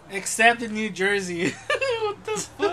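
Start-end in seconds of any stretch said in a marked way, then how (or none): 2.0-2.7 high, loud laughing what the fuck. Real, not acted. Friend laughing at New Jersey missing free tacos. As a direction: laugh it out in disbelief.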